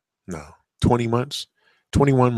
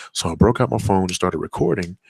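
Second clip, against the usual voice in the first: usual speaking voice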